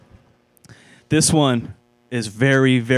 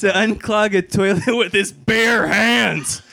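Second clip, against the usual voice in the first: laughing; 7 semitones higher than usual. Real, not acted. Newsreader cracks up mid-story.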